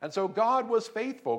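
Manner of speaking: usual speaking voice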